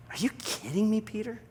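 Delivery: high, rising, light disbelief; incredulous. Acted. Jesus refuses Peter's resignation, incredulous. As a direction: ask it as if astonished.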